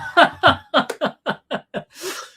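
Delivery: high, loud run of staccato laughter; real laugh. Real, not acted. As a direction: crack up at your own joke. Laughs at own joke.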